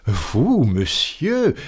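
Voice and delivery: high, incredulous cry without shouting; astonished exclamation. A narrator's reading. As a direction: exclaim it in disbelief, pitch leaping up. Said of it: "s'écria Fix".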